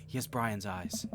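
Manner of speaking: usual speaking voice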